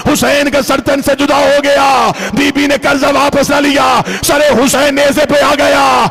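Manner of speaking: impassioned majlis preaching. Acted. Preacher recounting Karbala to mourners, impassioned lament. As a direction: press on at full force toward the climax.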